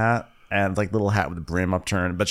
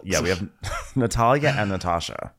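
ordinary delivery

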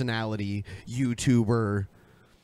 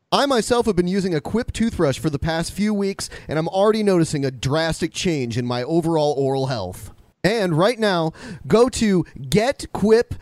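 higher, louder, punchy announcer-style read; sponsor ad read; 7 semitones higher, 3 dB louder than usual. Acted. Host selling the sponsor's toothbrush to listeners, ad read. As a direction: sell it like a podcast sponsor spot.

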